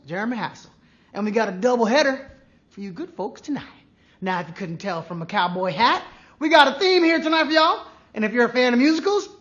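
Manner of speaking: hammed-up Southern cowboy accent. Host in cowboy hat welcomes players to a trivia night, cowboy accent. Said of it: "this horrible accent".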